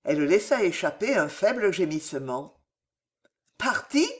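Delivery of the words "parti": petite question timide et aiguë; timidement. A narrator's reading. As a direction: demander d'une voix craintive. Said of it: "dit-elle timidement". (un «Parti ?» timide, presque effrayé)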